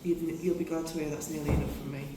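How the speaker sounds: ordinary talk, nothing marked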